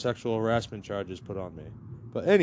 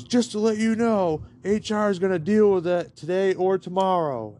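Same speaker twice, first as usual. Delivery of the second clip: owner's voice; 8 semitones higher, 4 dB louder than usual. Retold or imitated. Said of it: "He goes".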